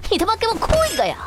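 cursing fast command; angry order. Acted. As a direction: bark it at him impatiently. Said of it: "我说你给我哭一个呀。".

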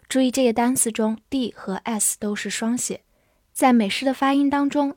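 ordinary talk

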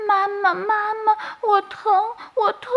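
high, small hurt chick calling mother; a hurt little bird whimpering for mum; baby bird crying. Acted. Injured chick in the nest calling its mother, high and pained.

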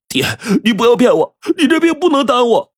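high, crying plea; tearful pleading. A narrator's reading. Son urging father to get treated, tearful pleading. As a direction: beg him in a high, weepy voice.

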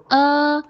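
long, held "呃", no r yet; first step of "er", a drawn-out "呃"; isolated schwa demo. Shown as a demonstration. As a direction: sustain "呃" high and steady. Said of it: "先发呃".

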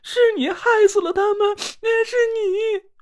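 put-on girl wailing at the man she blames; wailing accusation. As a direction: wail it open-mouthed. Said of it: "张着嘴大哭了起来".

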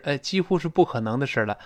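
normal talking voice

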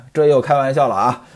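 ordinary delivery, nothing marked

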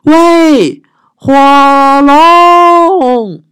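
very high, loud yell into the ear; shouting. Acted. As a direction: bellow to wake him. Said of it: "尽可能大声地喊".

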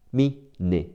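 ordinary talk, nothing marked

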